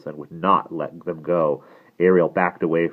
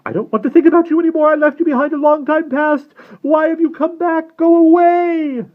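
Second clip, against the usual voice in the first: high, loud, panicked outburst; rushed start, long final wail; frantic; 12 dB louder than usual. A narrator's reading. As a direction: cry out frantically, as if haunted. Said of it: "grew frantic".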